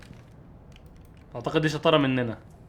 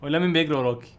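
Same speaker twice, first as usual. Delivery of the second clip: usual speaking voice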